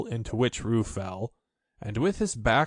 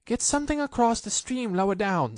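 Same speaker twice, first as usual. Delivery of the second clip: higher urgent call to the others; Rabbit calling; 10 semitones higher than usual. A narrator's reading. Rabbit calling to the others to help, high urgent. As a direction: call out orders in a hurry. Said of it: "called Rabbit".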